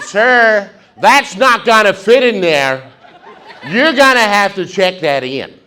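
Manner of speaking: high, loud, slow stewardess scold; stern stewardess. Retold or imitated.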